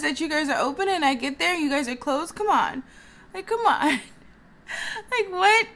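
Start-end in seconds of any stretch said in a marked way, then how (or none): 3.3-5.7 venting to viewers in high exasperated disbelief. Real, not acted. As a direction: throw your hands up, come on. Venting about rude customer.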